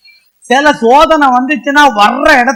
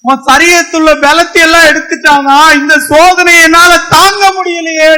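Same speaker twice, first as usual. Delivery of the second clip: rising, louder run of challenging questions; fervent preaching; 3 semitones higher, 4 dB louder than usual. Acted. Preacher asking the suffering congregation, growing louder. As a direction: press each question harder on the congregation.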